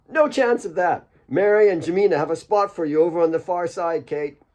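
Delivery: put-on high, bright boyish voice; a boy racing off to play; excited Asif. Asif cheerfully answering Kate as he drags Alex away.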